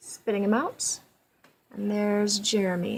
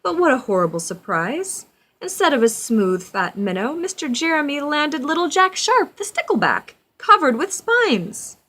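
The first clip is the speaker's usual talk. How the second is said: louder, higher build of a nasty surprise; dramatic storybook narration; 6 semitones higher, 9 dB louder than usual. A narrator's reading. Reading to a child, rising dramatic narration. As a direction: read it as a shocking twist.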